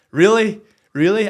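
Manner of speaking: loud, high disbelieving question; incredulous. Retold or imitated. Coach to referee on being sent off, loud incredulity. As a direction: throw it back loudly in disbelief. Reaction to red card.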